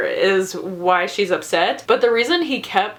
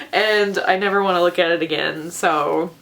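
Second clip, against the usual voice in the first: ordinary delivery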